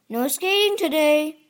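quick, firm warning. A narrator's reading. Mister Mole at the roped-off pond, warning the friends. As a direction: caution them briskly. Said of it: "warned Mister Mole".

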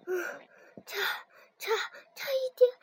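shaking, stammering after near drowning; shaky voice. Acted. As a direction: voice trembling with fright. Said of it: "声音还在发抖".

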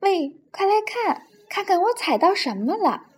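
calling out loudly. A narrator's reading. Penguin calling friend to see a find, mock shout. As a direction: hail a friend, a storybook shout held back. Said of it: "达芬奇大声地说".

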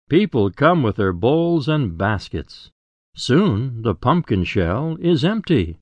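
ordinary delivery

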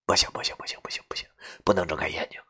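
frantic whispered self-talk; hushed desperate no, no, no; panicked whisper. Acted. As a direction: whisper it, too scared to breathe. Panicked inner refusal, eyes shut.

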